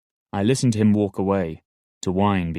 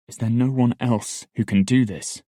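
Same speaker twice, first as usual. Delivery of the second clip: begging; 4 semitones higher than usual. A narrator's reading. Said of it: "I begged him".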